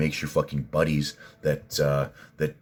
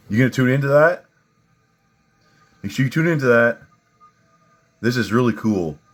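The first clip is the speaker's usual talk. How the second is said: mocking hype; 8 semitones higher, 7 dB louder than usual. Real, not acted. Mocking a TikTok live.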